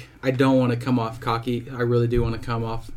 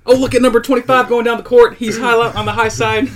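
high, loud play-by-play caller; sports announcer voice; 11 semitones higher, 8 dB louder than usual. Shown as a demonstration. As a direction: call the game like a hyped announcer. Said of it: "what's your announcer voice sound like".

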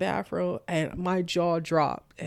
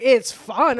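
loud, high mocking echo; teasing mimicry; 4 semitones higher, 9 dB louder than usual. Dad teases his daughter for liking motherhood, mocking echo. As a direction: throw it back louder and higher. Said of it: "Not like that".

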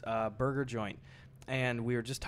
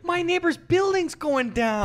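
mock panic; 9 dB louder than usual. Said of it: "joining the bit".

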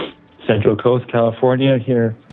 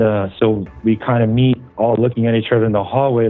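usual speaking voice

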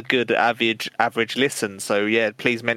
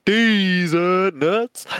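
drawn-out joke; 7 semitones higher, 4 dB louder, much slower than usual. Pretend. Punning plug, drawn out.

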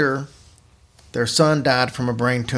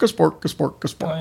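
Swedish Chef; 3 semitones higher than usual. Retold or imitated. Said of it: "a lot like the Swedish Chef".

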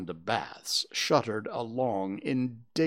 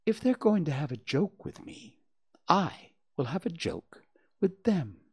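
lightly voiced mother, pitched higher; Kanga plotting to herself; 7 semitones higher than usual. A narrator's reading. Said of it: "So she said to herself".

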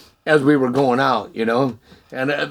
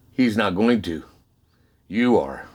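low, calm, final; voice of God; 8 semitones lower, 4 dB quieter than usual. Retold or imitated. God hands the man his task, low calm divine voice. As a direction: lower and measured, leaving no room for argument.

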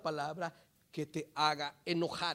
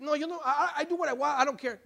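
mock indifference; 9 semitones higher, 9 dB louder than usual. Mimics people claiming not to care.

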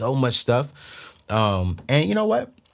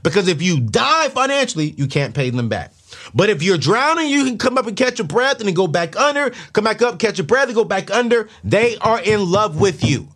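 preaching at full force; 9 semitones higher, 4 dB louder than usual. Acted. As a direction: preach it like a fired-up pastor. Preaching about lenders and debt.

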